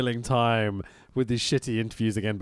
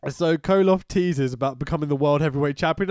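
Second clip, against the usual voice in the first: ordinary talk, nothing marked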